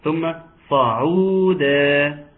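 صعودا drawn out very slowly, round ع; very slow recitation of صعودا; slow demo. Shown as a demonstration. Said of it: "ضم العين جيدا".

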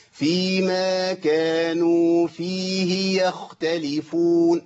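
slow chanted recitation with exact timing; measured recitation. Shown as a demonstration. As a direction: recite each length deliberately. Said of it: "أنا بضبط إيه؟ أزمنة". (تلاوة بطيئة بأزمنة مضبوطة)

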